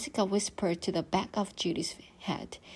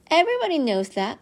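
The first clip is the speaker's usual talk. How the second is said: higher, fast smug brag; know-it-all; 8 semitones higher, 5 dB louder, much faster than usual. Acted. Stink lectures his sister, smug and quick. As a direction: rattle it off like it's obvious.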